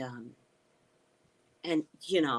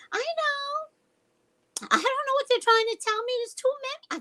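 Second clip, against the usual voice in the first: very high, squeaky overwhelmed cry; squealing overwhelm; 4 dB louder than usual. Real, not acted. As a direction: squeal it like it's all too much. Overwhelmed by the bugs in chat.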